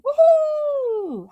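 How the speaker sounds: a high, whooping cheer; excited woohoo. Retold or imitated. Reading an excited comment.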